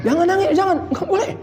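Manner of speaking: high mock nagging, half laughing; fussy scolder. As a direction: mimic the busybody forbidding tears. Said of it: "Jangan ngada-ngadain hukum". (meniru orang yang melarang menangis, nada tinggi)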